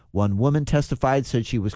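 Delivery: usual speaking voice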